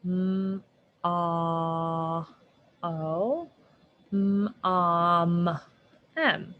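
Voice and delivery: "Mm" and "M" drawn out sound by sound; sounding out words. Shown as a demonstration. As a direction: stretch each "Mm" sound. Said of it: "What, I hear an M?".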